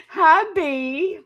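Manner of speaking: ordinary talk, nothing marked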